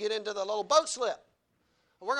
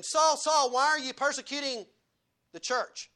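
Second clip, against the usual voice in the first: Jesus calling Paul; 6 semitones higher, 6 dB louder than usual. Acted. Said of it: "Jesus confronts Paul and he says".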